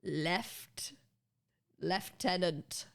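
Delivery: lieutenant slowly, opening with "left"; lieutenant with an F sound, drawn out; British pronunciation. Shown as a demonstration. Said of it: "we pronounce this word as left".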